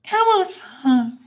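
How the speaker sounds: tiny, very high puppet voice, drawn out; Elmo impression. Uncle entertaining his nephews, squeaky puppet impression. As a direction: squeak it slowly like a little furry puppet. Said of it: "who else I used to do is Elmo".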